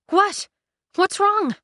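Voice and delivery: high startled question; startled cry of what; alarmed. Acted. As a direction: jolt up in alarm. Cinder alarmed.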